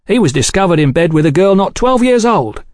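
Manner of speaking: high, emphatic disclosure; scandalised revelation. A narrator's reading. Velasco exposing Falk's disgrace to Oliphant, relishing effect. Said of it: "He paused for effect".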